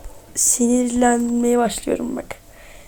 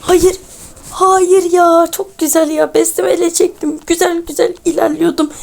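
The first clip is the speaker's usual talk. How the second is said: higher-pitched, real frustrated complaint; dismayed protest; 5 semitones higher than usual. Real, not acted. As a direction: wail of a gamer losing progress. Fails after good progress, protests.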